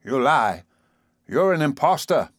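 high-pitched, outraged denial; indignant outburst; angry accusation. A narrator's reading. Father angrily accuses the old man.

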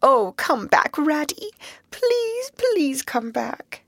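painful appeal. Acted. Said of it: "the sharp note of painful appeal in his voice".

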